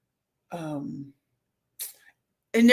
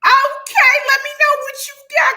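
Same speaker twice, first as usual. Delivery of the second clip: high, loud sing-song hype; gleeful high-pitched shout-out; excited squeal; 9 dB louder than usual. Real, not acted. Hyped greeting of a commenter.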